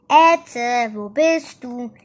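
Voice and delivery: loud searching call. A narrator's reading. Igitte searches for missing Ette, calling out. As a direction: call out for someone who is missing. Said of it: "Rief Igitte".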